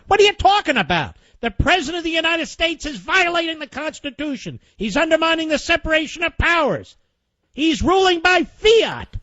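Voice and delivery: exasperated disbelief that rises into a tirade; outraged rant. Real, not acted.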